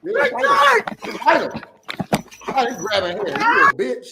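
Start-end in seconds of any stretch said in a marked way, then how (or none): 0.0-2.8 excited cheering. Real, not acted. Viewer cheering on the fight. 3.3-4.1 yelling. Real, not acted. Viewer yelling at the officer.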